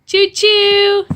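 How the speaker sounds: high choo-choo like a toy train. Shown as a demonstration. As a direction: call out choo-choo. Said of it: "What does a train say?".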